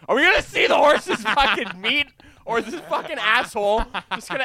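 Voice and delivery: high, loud, laughing impatience; 恋人の真似で、笑いながら高く大声でじれったそうに; impatient mock whine. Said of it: "Alana's like".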